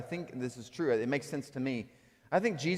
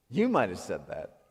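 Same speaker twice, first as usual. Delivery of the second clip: normal talking voice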